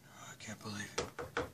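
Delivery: soft unvoiced whisper; whispered aside. Dad muttering aside before the police knock, whispered. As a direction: whisper so nobody hears.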